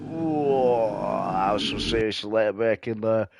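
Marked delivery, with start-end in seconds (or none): 0.1-1.3 long drawn-out whoa, sliding down in pitch; impressed whoa. Real, not acted. Commentator reacting to very late braking, impressed. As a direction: let out a long, amazed "whoa".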